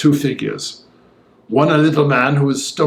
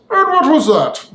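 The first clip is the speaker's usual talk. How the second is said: suddenly much higher, curious question; Utterson, changed voice; 12 semitones higher, 3 dB louder than usual. A narrator's reading. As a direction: let Utterson's voice lift sharply. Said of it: "with a slight change of voice".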